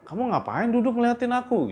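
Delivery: high, lightly acted questioning; mock wondering, pitched well up; puzzled wife. Leli questions Anto on waking.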